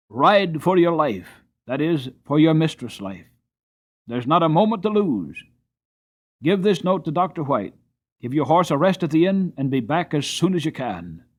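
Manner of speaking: no time to lose, push him; urgent orders. A narrator's reading. The squire orders John to ride for his wife's life, loud and urgent.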